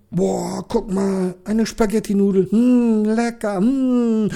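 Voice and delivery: high, excited, smacking his lips; delighted policeman. Acted. Policeman spots the spaghetti noodle, high and greedy delight. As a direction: gush over a tasty treat.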